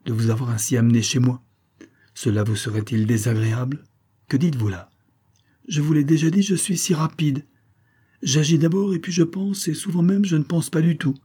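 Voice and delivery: coquettish, carefree chatter. Acted. Emilie voiced by narrator.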